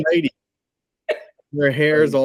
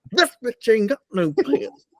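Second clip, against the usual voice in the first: quoting Tyson; 8 semitones higher than usual. Retold or imitated. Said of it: "he was like".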